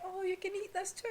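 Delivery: soft, high, sweet imitated voice; sweet Tanjiro. Kind friend sharing food in a flashback, sweetly. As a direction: gently offer it, high and light. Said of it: "Tanjiro being so sweet".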